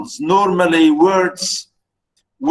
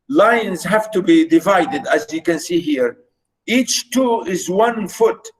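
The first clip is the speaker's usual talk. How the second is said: ordinary talk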